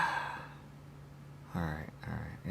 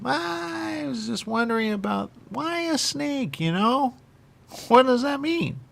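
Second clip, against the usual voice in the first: odd high voice; 9 semitones higher, slower than usual. Real, not acted. Channeled voice asks questions.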